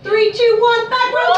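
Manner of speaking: high, rousing countdown to a crowd. Real, not acted. Host winds up the back row for the next act, high countdown. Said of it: "I'm gonna count down".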